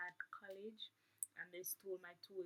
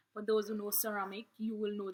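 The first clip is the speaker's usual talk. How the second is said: loud, emphatic retake; louder retake; 2 semitones higher, 15 dB louder than usual. Shown as a demonstration. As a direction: say it again with full energy. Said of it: "My bad".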